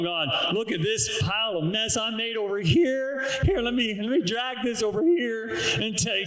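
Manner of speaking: a hint of a cheerful sinner passing off his trash; believer handing God trash. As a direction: breezily hand your mess over to God.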